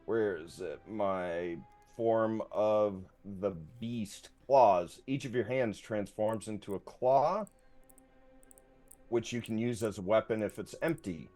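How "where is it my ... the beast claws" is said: ordinary talk, nothing marked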